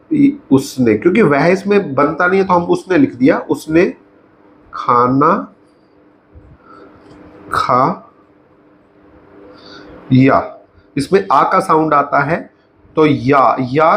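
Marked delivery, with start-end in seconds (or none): 3.4-10.6 long pauses between the pieces; slow dictation. Shown as a demonstration. Teacher writing out the past simple example, slow dictation. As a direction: break it up as you write. Saying words while writing them out.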